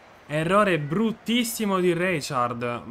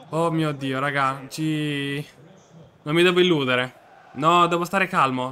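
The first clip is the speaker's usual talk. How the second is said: usual speaking voice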